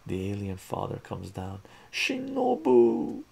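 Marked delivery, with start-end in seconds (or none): 1.9-3.2 high, loud, slow plea of her name; drawn-out call. Acted. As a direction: stretch out her name as she leaves. Ataru calls to departing girlfriend.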